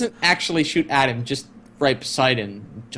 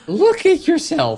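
laughing; 13 semitones higher, slower than usual. Retold or imitated. Retold laughing line.

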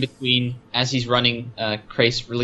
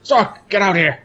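higher, half-hammed call for Stark; mock yell; 6 semitones higher, slower than usual. Rigel calling Stark over, mock yell. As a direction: shout for him across the room.

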